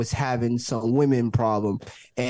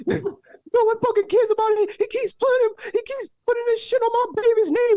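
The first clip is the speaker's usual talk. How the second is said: very high, loud falsetto shriek; mock meltdown in a squealing falsetto; hysterical woman; 3 dB louder than usual. Storyteller imitating neighbor's meltdown as cops get her, hysterical falsetto.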